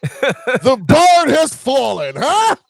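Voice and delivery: high gleeful laughing gloat. Real, not acted. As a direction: gloat over the win with laughter. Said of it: "A little too excited".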